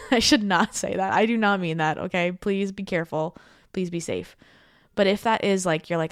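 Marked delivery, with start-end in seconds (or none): none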